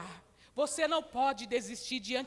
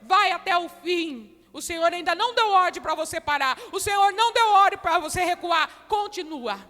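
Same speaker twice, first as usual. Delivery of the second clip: much louder, driving sermon; full-force preaching; 6 semitones higher, 12 dB louder than usual. Acted. Preacher urging the women on, full force. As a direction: push them not to give up.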